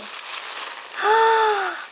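long, high-pitched gasp; shocked gasp. Real, not acted. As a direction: gasp in real amazement. Discovers another gift in box.

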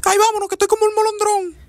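put-on a high squeaky girlfriend voice; high girly voice. Girlfriend refusing zombie films in a high squeaky voice. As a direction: pipe it up high like a fussy young woman.

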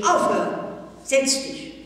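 scharf und laut, viel höher gerufen; brüll das Kind zur Ordnung; Schrei. A narrator's reading. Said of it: "die Frau Direktor schrie". (die Direktorin schreit die tobende Schülerin an)

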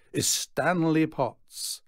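slow, loud, grand introduction; showman's announcement. A narrator's reading. Showman presents Stanley to crowd.

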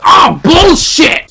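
very loud, high, drawn-out yell; sudden full-voiced protest; outraged shout. Real, not acted. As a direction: explode at an unfair game. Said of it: "Sorry if I'm shouting".

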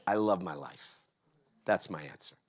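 soft, low and heartfelt; hushed sincerity. Real, not acted. Speaker shares the answer after hyping it, hushed sincerity.

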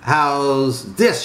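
ordinary delivery, nothing marked

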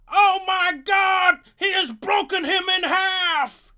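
shouted excited commentary; wrestling commentator. Acted. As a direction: yell like a ringside announcer.

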